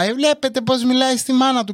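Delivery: high-pitched appeal to onlookers; wronged mother. Retold or imitated. Mother complaining to shoppers, theatrically offended. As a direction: imitate mum playing the victim. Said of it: "έτοιμη ήταν να αρχίσει".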